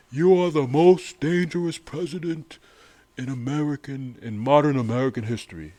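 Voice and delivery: mock Bernie impression; impersonating Bernie. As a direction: ham up Bernie calling out the president. Voicing Bernie's debate line.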